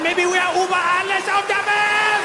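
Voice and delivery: high gloating anthem line; triumphant. Pretend. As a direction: belt it out proudly.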